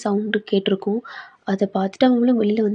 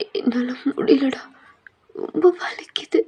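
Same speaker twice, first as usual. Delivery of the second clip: high, whispery voice of hurt; acted anguish, thin and strained; pained, breaking down; 4 semitones higher than usual. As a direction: confess that you can't bear it. Thayang confesses pain to friend.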